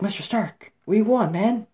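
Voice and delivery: put-on high, pleading young hero voice; imitated Spider-Man. Spider-Man to dying Mister Stark, high and pleading. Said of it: "Spider-Man came up to him".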